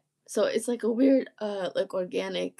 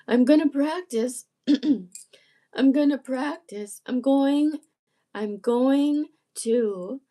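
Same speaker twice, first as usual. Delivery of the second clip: higher, louder, deliberate speaking up; practising the therapist's higher placement; 5 semitones higher, 4 dB louder than usual. Shown as a demonstration. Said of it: "speaking up here more deliberately".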